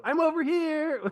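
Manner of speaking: very high, tiny voice calling out; an extra answering the director from off to the side; squeaky offstage voice. Retold or imitated. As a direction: pipe up like a timid extra. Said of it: "off to the side go".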